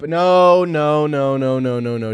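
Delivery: loud protest that gradually settles; emphatic denial. Real, not acted. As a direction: cut yourself off with a big backtracking no. Hastily retracting deep-voice remark.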